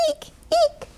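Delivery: tiny, soft, very high squeaks; mouse squeak. A narrator's reading. Mice scampering in the stable, soft high squeaks. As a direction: squeak softly like a little mouse. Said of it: "squeaked the scampering mice".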